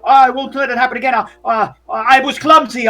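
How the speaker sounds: high, loud, panicky grovelling; apologetic servant. Acted. Fred apologizing to the man he bumped, flustered. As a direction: stammer out hurried apologies. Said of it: "Fred's like".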